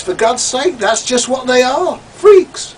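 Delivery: shrill, loud exasperated rant; spitting out the word freaks in disgust; exasperated outburst. Acted. Ranting to the listener about freaks, loud and exasperated.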